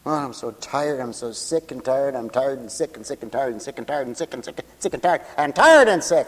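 soft, low grumble speeding into a chant; mock-tired litany, low and soft; weary grumbling. Voices a weary complainer.

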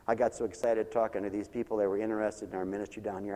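normal talking voice

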